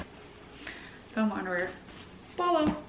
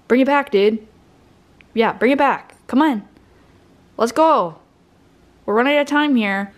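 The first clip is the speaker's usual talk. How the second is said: loud coaxing call to the cat, bring it back; 9 dB louder than usual. Real, not acted. Urging her cat to fetch the toy, loud calling. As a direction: shout encouragement across the room.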